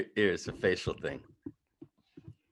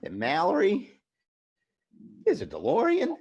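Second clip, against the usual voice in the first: Michael J. Fox; 7 semitones higher than usual. Acted. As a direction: voice climbing higher in disbelief. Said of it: "my impression of Michael J. Fox".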